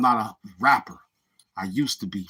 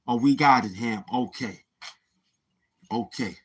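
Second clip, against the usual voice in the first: ordinary delivery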